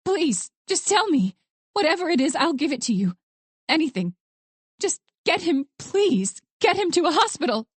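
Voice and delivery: high, desperate pleading; frantic plea, unable to stop; rising panic. A narrator's reading. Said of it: "her volume rising as her panic grew".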